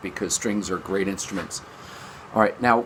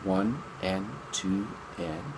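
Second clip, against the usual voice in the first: slow, much softer counting; 8 dB quieter, slower than usual. Shown as a demonstration. Teacher demonstrates the eighth-note count, slow and soft. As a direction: count the eighth notes slowly. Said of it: "on the eighth notes my bow moved back and forth".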